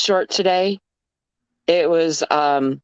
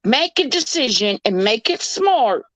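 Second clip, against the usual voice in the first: high, sweetly scolding Southern impression; cute Southern lady; 6 semitones higher than usual. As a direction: imitate a Southern belle warning off a burglar. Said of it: "this s-, cute Southern lady comes on".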